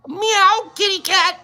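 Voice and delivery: a high playful "Meow" then kitty cat; high mock cat voice. Cat sound for Hip Kitty.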